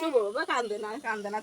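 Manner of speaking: calm him down tenderly; soft hushing. Real, not acted. Mother softly hushes her crying little boy.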